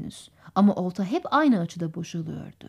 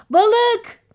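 put-on high, loud shout of a boy; excited shout; 12 semitones higher, 7 dB louder than usual. A narrator's reading. Young Tom spots a fish from the boat, shouting. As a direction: cry out the alarm, high and sudden. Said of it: "diye bağırdı genç Tom".

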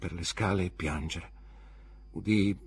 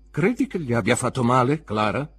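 louder, heavy and stern; grave question; 3 semitones higher, 7 dB louder than usual. A narrator's reading. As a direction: ask it gravely, as a warning. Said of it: "domandò gravemente mister Marston". (domanda grave e severa, più forte)

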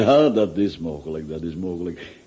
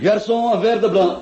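higher, raised call in French; calling the waiter; 11 semitones higher than usual. Acted. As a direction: hail the garçon across the room. (de ober roepen)

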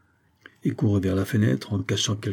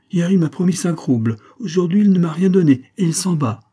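high, loud, aggrieved protest; indignant complaint; 8 semitones higher, 6 dB louder than usual. A narrator's reading. Émilie to the departing lieutenant over promised money, indignant. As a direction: complain loudly in a raised pitch.

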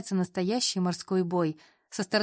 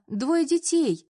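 mock outrage; 8 semitones higher, 5 dB louder, much slower than usual. Mother lamenting about grandchildren.

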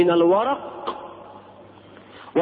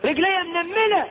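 complaining about numb leg; 9 semitones higher than usual. Retold or imitated. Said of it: "آخر".

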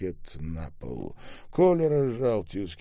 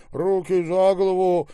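loud, high-pitched order; barked command; 10 semitones higher, 9 dB louder than usual. A narrator's reading. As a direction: command them at a shout. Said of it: "скомандовал Таляпа". (громкая высокая команда)

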